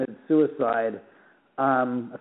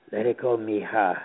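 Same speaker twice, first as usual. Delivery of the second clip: low, hushed man's voice close to the mic; lightly voiced spirit whisper from a recorder; a hint of a low ghostly voice; imitating ghost voice; 5 semitones lower than usual. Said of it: "And it was like this".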